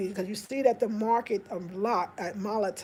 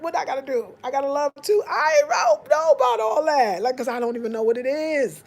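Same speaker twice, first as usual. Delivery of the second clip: usual speaking voice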